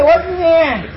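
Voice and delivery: the lioness can't believe that bleat; lioness surprised. Acted. Lioness questions his weak bleat.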